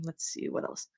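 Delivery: low whispered mutter; muttering to self. Real, not acted. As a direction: mutter it under your breath. Muttering while scrolling.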